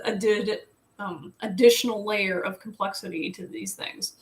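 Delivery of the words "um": ordinary talk, nothing marked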